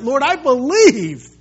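loud, high, slow declaration of faith; acted tearful cry-out. Father on his knees before Jesus, acted loud cry of belief. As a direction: call it out with desperate conviction. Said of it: "he cries out with tears".

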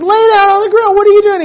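very high, loud scolding command; retold yell. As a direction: bark orders at the teenagers. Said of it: "he yells at them".

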